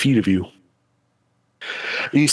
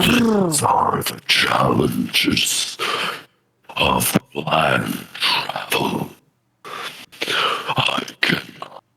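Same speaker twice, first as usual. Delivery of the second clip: much lower, very slow monster voice; deep bellowing voice; 10 semitones lower, much slower than usual. Acted. DM voices the kraken, deep and slow. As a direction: rumble it slowly from the deep. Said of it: "a deep bellowing voice".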